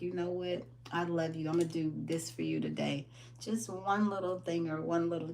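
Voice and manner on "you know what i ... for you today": soft, gentle mirror pep talk; tender self-talk. Pretend. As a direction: say it to yourself with love, quietly. Talking tenderly to yourself in mirror.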